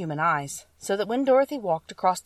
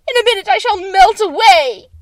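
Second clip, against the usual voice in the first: witch screaming; 14 semitones higher, 14 dB louder than usual. Acted. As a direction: shriek in horror as you melt. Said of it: "she screamed".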